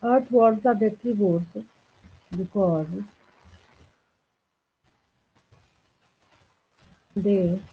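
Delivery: slow, measured read-out for note-takers; dictation. Real, not acted. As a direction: dictate word by word, leaving long gaps. Dictates notes while writing.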